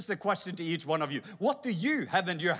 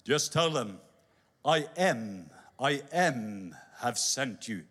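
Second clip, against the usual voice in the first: lower, slow, weighty pronouncement; voice of God; 7 semitones lower, slower than usual. Acted. God answering Moses, low and slow.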